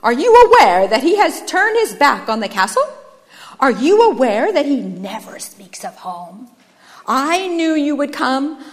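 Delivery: loud, theatrical fault-finding; declamatory, self-righteous accuser; shouted denunciation. A narrator's reading. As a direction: proclaim another's wrongs with relish. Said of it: "he shouted".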